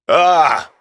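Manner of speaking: loud, high yelp of pain; sharp pained cry. Acted. Johnny jolted by sudden pain, yelping.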